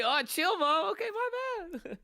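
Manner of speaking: high-pitched mock protest to mom; playfully exasperated kid reply. Retold protest back to mom.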